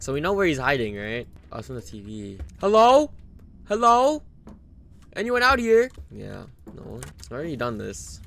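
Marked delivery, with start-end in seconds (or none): 2.6-5.9 high, loud rising calls; calling out. Real, not acted. Player checks for an intruder, calling out. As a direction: call into an empty house.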